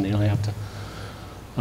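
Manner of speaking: slow, breathy whisper; whispered aside. Real, not acted. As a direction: drop it to a slow, bitter whisper.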